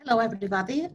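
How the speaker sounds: ordinary talk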